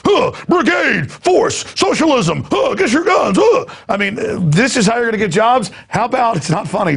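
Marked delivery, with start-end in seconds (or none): 0.1-3.7 very high-pitched mock militant shouts; hammed-up squealed slogans with grunted huhs; mock youth brigade. Host mocking national service youth in red jackets, high parody chant. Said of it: "khaki pants going".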